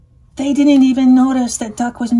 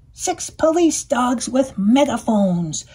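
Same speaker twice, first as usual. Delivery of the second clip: plain reading voice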